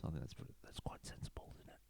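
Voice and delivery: unvoiced whisper under the breath; whispered aside. Real, not acted. Whispering an aside to the viewers. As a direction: drop to a private whisper.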